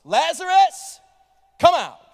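calling out loudly, commanding. Acted. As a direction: call him out of the tomb. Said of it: "called out in a loud voice".

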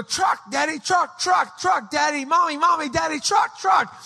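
put-on high, urgent toddler voice; excited young child. Toddler calling parents to see a truck, excited high child voice. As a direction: call out excitedly like a little kid. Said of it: "when I was very young".

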